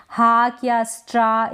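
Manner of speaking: हाक and स्ट्रा with open "aa"; wrong aa vowel. Shown as a demonstration. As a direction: say हाक, स्ट्रा as "haak", "straa". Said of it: "ये गलत हो जाएगा".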